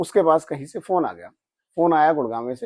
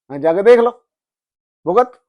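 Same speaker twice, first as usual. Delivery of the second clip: loud, higher retold snap; angry outburst; 6 semitones higher, 9 dB louder than usual. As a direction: fling it out in irritation. Retold outburst at goddess's complaint. (झुंझलाकर ऊँची आवाज़ में)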